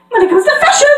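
high, loud theatrical flourish; fashion pony impression. Fashion pony boasting of superiority, high dramatic cry. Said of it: "next is Rhodey".

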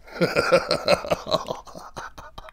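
mocking laugh. Reflection mocks him.

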